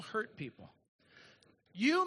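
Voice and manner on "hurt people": quiet echo. Shown as a demonstration. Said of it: "And it's this".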